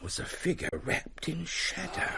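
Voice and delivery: whispered, hushed ghost-story suspense; spooky hush. Acted. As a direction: draw them in with a breathy hush.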